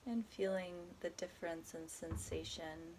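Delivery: calm, unhurried body scan guidance with long pauses; guided meditation. Acted. Guiding a listener through a body scan, soft meditation voice. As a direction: ease the listener gently from one body part to the next.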